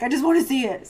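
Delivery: retold eager plea. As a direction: imitate a friend itching to look. Quoting friend's eager plea.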